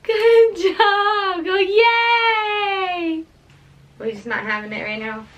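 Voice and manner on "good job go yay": high loud cheer. Real, not acted. Cheering baby to clap.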